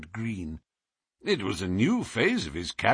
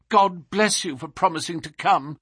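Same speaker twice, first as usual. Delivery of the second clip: higher, fervent exclamation of thanks; grateful cry; 8 semitones higher, 4 dB louder than usual. A narrator's reading. Client thanking the detective for promising to come, fervently. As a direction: cry out with heartfelt relief. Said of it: "cried our client".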